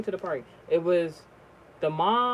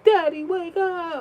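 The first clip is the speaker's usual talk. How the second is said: put-on high, slow child's plea to a father; drawn-out, high-pitched pleading, acted as the son; imitated crying son; 11 semitones higher, slower than usual. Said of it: "was screaming and crying and shit".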